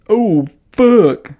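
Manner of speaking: high imitated groan; mock moan. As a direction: groan it again as a joke. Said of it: "recalling the guy's groan".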